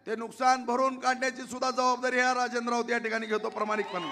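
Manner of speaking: usual speaking voice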